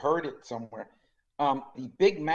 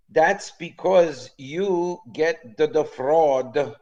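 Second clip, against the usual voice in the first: put-on slow, lightly Italian-accented waiter; a hint of an Italian waiter explaining slowly; 3 semitones higher, 5 dB louder, much slower than usual. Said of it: "your Italian accent is excellent".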